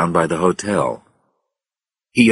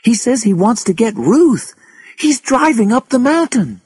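very high, alarmed voice; panicked news, cried out high; crying out; 4 dB louder than usual. A narrator's reading. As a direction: voice pitched right up in alarm. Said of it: "she cried".

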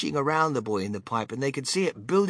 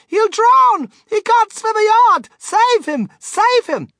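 very high, loud frantic mother; panicked screaming; 10 dB louder, slower than usual. A narrator's reading. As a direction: shriek in terror for your child. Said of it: "screamed Missus Gloop".

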